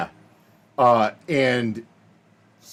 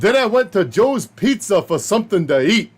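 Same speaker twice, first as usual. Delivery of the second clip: put-on coworker's New York–Chicago accent; 7 semitones higher, 3 dB louder than usual.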